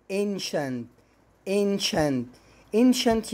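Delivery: ancient said ayn-shent; teacher demo of ancient with sh; sh sound in ancient. Shown as a demonstration. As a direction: ancient with sh, not s. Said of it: "الكلمة اللي بعديها اي ان".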